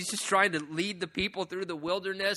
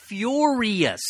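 furious punched loud and high; preacher stressing furious, loud; 5 semitones higher, 8 dB louder than usual. Real, not acted. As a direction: hit the word furious hard. Preacher stresses God's anger.

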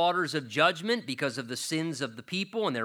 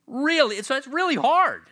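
much higher and louder, beaming over the grandsons; delighted exclamation; 11 semitones higher, 7 dB louder than usual. Real, not acted. Pastor gushing to the congregation about grandsons, excited and much higher. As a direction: burst out with real grandparent joy.

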